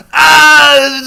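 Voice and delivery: long, high, very loud "ah"; loud outcry. Real, not acted. Reacting to a contentious fan claim, long high yell. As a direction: let out a drawn-out yell.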